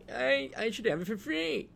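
high mocking whine; mock an entitled freeloader; high put-on whine insisting on free stuff. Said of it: "crap bird and think, like".

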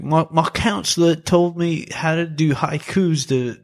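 put-on kid's line quoted in a high, light British accent. A fan quoting the movie kid's haiku line, in British accent. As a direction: mimic the chubby kid with a British-sounding lilt. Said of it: "why does it sound British?".

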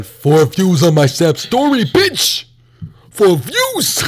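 loud, high outburst over the views; hammed-up shock at so few views; mock outrage. Real, not acted. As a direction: shriek it in mock indignation.

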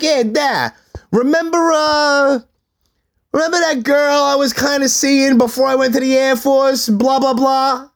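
high-pitched, sing-along casual fibbing; put-on innocent pitch. Son spinning a story to his stepfather, mock-innocent.

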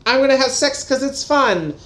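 high, breezy mock roommate voice; playful high-pitched impression of a roommate; carefree roommate. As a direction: toss it off high, as the fun-loving friend. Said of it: "Shelley is bi and just like".

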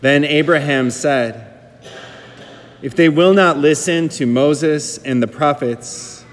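slow, solemn scripture reading; Gospel reading. Acted. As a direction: read it like a lector at Mass.

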